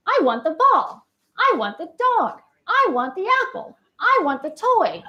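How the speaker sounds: high, sing-song repeated pattern; same tune every line like a script. Shown as a demonstration. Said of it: "what we call stereotype prosody".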